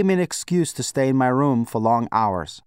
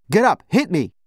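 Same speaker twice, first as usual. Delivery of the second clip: higher, raised-voice commands; angry goading; 6 semitones higher, 4 dB louder than usual. A narrator's reading. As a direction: goad him into fighting back. Goading friend to fight back.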